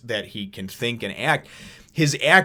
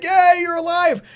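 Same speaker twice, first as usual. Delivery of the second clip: high, slow cheering yell; Usopp shouting; 6 dB louder, slower than usual. Retold or imitated. Usopp joining the cheer for Luffy with a high yell. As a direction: echo the cheer at full voice. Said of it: "Usopp's like".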